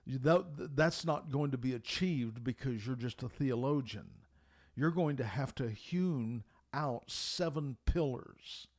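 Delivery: ordinary talk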